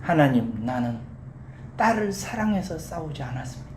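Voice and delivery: soft, low opening of a confession; penitent prayer. Acted. Young mother repents before God, soft penitent prayer. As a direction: confess quietly, pausing between phrases. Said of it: "하나님 앞에 회개하기 시작합니다".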